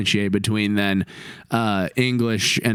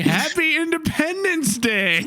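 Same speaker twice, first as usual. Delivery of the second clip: 1 mock happy greeting. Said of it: "I was just like".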